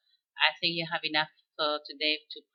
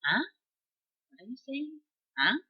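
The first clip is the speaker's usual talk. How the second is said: French huh; 8 semitones higher than usual. Shown as a demonstration. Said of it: "You can sound French".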